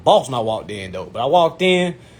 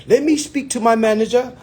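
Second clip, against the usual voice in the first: put-on high-pitched African-accented salesman; imitated African accent; 10 semitones higher than usual. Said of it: "That's when the accent came back".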